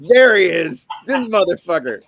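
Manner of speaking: loud, high greeting shouted across the room; mock excited shout. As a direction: hail him like an old friend, loudly. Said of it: "I'm like".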